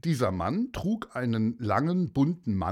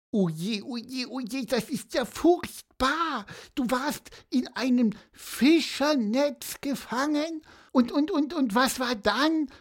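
higher, worried little voice; Pieper, dismayed and eager; 13 semitones higher than usual. Acted. Pieper urges the seahorse on, higher and eager. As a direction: gasp and beg for more of the story.

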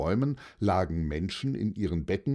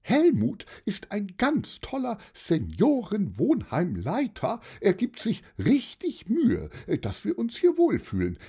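high-pitched aunt full of praise; high, emphatic and appreciative aunt; jolly old aunt, enthusiastic; 11 semitones higher than usual. Acted. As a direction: rave in a high auntie voice.

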